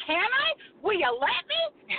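high, bright-eyed asking; mock-innocent eager please. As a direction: beg sweetly to be let in the ring. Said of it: "And I'm like".

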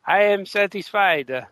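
slow, deliberate mock satisfaction; retelling an artist's line at dinner, slow and content; Francisco Francavilla. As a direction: savour each word like after a fine meal. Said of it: "and says".

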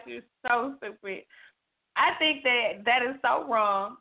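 usual speaking voice

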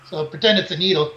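ordinary delivery

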